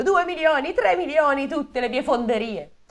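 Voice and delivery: mock shout raising the reward; yelling offers. The king of steel shouting his reward to the room, high yell. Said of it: "urlava il re dell'acciaio".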